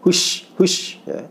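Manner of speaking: slow breathy hush like shooing chickens; drawn-out shooing hush. Shown as a demonstration. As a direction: push air out, hush, hush. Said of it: "mengusir ayam".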